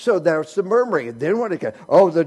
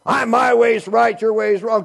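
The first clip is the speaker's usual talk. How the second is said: mock quarrel; 4 semitones higher, 6 dB louder than usual. Quarreler voiced.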